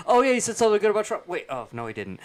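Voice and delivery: loud, fast, high mock excitement; gush, then catch yourself; mock excited Republican. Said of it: "the Republicans are like".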